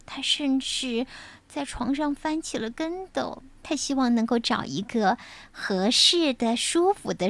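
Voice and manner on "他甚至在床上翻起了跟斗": ordinary delivery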